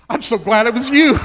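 loud, laughing through the words. Retold or imitated. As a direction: burst out laughing with relief. Son's joyful punchline.

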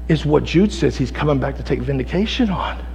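usual speaking voice

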